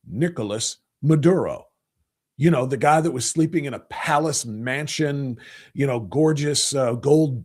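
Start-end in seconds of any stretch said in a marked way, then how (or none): 0.1-1.7 "Nicolas Maduro" in plain American English sounds; English pronunciation. Shown as a demonstration. Host shows how the name is pronounced in English, Anglicized "Nicolas Maduro". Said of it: "his name pronounced in English is".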